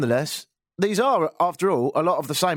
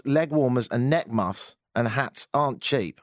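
ordinary talk, nothing marked

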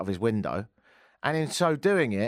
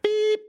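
very high, loud answerphone beep; mouth-made beep; 5 dB louder than usual. Retold or imitated. Faking an answer phone, high mouth-made beep. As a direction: imitate the machine's tone, sustained and high. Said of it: "make a beep with my mouth".

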